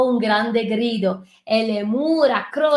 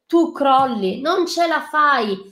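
ordinary delivery

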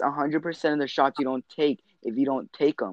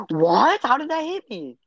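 high outraged disbelief; raging gamer; 11 semitones higher than usual. Real, not acted. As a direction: yelp it, can't believe it hit you. Hit by a bug mid-game.

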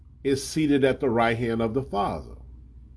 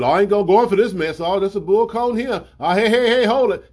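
louder, higher, fast agitated protest; agitated Peter; 7 semitones higher, 6 dB louder than usual. Acted. Disciple Peter protesting the arrest, agitated and loud. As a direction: Peter flaring up, ready to fight.

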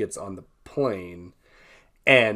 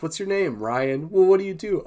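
higher, louder quick questioning; mock interrogation; 8 semitones higher, 4 dB louder than usual. Podcaster voicing a couple questioning Ryan, mock inquisitive.

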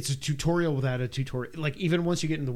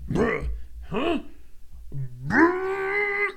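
startled enemy; much slower than usual. Retold or imitated. Enemies noticing him.

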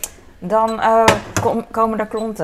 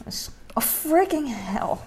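slow, higher, drawn-out curse; startled curse; 6 semitones higher, 6 dB quieter, slower than usual. Real, not acted. Spilling batter on the counter: startled slow curse. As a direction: caught off guard by spilling, stretch it out. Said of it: "ik zei de tweede keer geen freaking hell".

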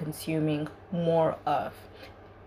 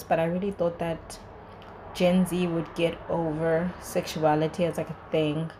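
ordinary delivery

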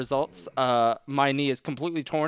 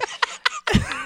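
high breathy laugh. Real, not acted. Laughs at the bit.

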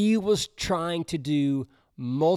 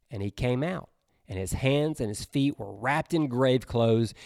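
ordinary talk

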